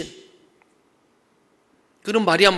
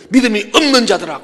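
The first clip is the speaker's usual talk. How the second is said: loud, higher-pitched reproach; harsh rebuke; 4 semitones higher, 8 dB louder than usual. Acted. Preacher quotes Jesus rebuking the faithless, harsh and loud. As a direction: come down hard on them, loud and raised. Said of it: "조금 부드럽게 하고, 어때?".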